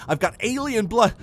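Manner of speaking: loud, high-pitched insistence; agitated urgency. A narrator's reading. Husband telling wife of alien attack, agitated. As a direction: raise the voice in alarm.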